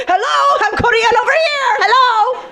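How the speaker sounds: high, eager calling out to a cute guy; flirty call-out. Pretend. Woman calling out to a handsome guy, high and eager. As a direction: wave him over in a bright, high call. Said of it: "you'd be like".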